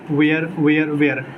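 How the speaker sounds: "where" said with an open e, like vay-ur; mispronounced were. Shown as a demonstration. Tutor repeating what childhood teachers taught, "where" with a wrong open e. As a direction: say "where" as two-beat vay-er, drilled by rote. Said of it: "बचपन से teacher सिखाते हैं क्या".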